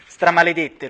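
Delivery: roared curse, loud and booming; furious booming curse at the locked door. A narrator's reading. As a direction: bellow it like from inside a barrel. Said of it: "ruggì come rimbombando da una botte".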